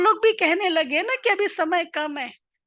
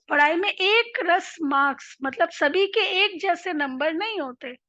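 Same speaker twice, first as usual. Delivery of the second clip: usual speaking voice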